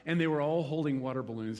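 ordinary talk, nothing marked

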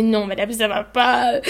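slow, low-pitched voice pushed out through labor pain; deep pained voice. Retold or imitated. Mother in labor answers the midwife in a low voice. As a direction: drag the words out low and heavy. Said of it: "une espèce de voix grave que j'avais".

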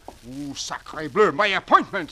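put-on panicked French accent; frantic French dandy rushing off.

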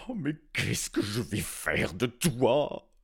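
slow, put-on king's voice, loud; slow despairing old king; king's lament. A narrator's reading. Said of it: "hurla-t-il".

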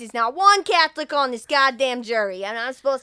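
loud, indignant rant about the jury; ranting lawyer. Retold or imitated. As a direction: build into an outraged shout.

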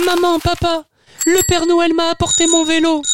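put-on high thrilled child voice; a kid showing off a new bike; overjoyed child crying out. Tutu calling to mother and father, high thrilled child voice. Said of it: "s'écria Tutu".

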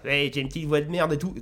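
lightly mocking a whiny game hero; a hint of a weak, pathetic hero voice; crappy little voice. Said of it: "le mec il parle comme ça".

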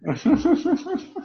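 high squeaky quacking laugh; duck voice laugh. Real, not acted. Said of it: "j'ai fait une voix de canard". (rire en voix de canard)

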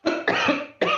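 harsh coughing fit; choking cough. Real, not acted. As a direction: cough as if a drink went down wrong. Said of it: "excusez-moi, je vais être travers".

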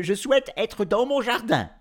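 very high, loud declaration; bold declaration. A narrator's reading. Professor wishing himself into his garden, proclaiming. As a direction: proclaim the wish high and strong. Full wish made aloud.

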